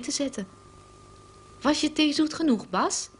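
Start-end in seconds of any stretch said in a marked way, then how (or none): 1.6-3.1 Barbara, high and innocent. A narrator's reading. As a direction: feign innocence, pitch well up. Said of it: "vraagt Barbara onschuldig".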